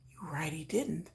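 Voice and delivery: breathy whisper, no voice; whispered agreement. Pretend. Agreeing with her doubts in bed, a whisper. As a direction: whisper it, conspiratorially.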